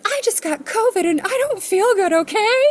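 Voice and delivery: high, loud, exasperated mock outburst; Mother Earth. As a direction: snap back high and loud, sick and fed up. Mother Earth snaps back, acted.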